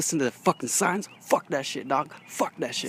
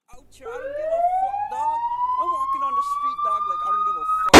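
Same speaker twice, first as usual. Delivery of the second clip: high mock swagger; 6 dB louder than usual. Strutting street boast, sung high.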